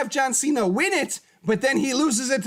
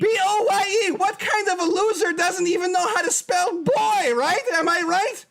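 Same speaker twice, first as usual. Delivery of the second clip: wrestling promo; 4 semitones higher than usual. Acted. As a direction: rant at the rival like a wrestler on the mic. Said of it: "I had to go on a little bit of a rant there".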